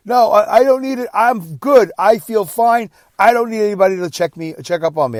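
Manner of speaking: high, loud, insistent protest; vehement refusal. Retold or imitated. Father refusing a health check from his son, vehement. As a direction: imitate a stubborn man refusing any help. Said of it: "just really vehemently with the no".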